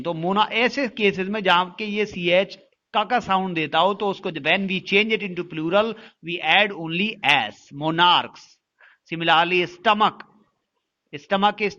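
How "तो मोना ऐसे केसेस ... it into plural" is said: usual speaking voice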